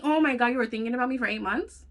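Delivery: ordinary talk, nothing marked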